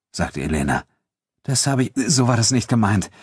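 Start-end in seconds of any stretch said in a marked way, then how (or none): 1.4-3.1 hurried, flustered correction at a higher pitch; raised-pitch backpedalling; hasty. A narrator's reading. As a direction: break off and hastily take it back. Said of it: "sagte André hastig".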